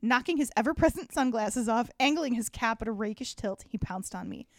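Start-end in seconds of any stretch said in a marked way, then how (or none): none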